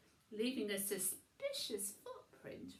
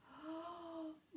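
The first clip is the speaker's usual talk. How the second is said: gasp; 7 semitones higher, 4 dB quieter than usual. A narrator's reading. Winnie finds odd footprint.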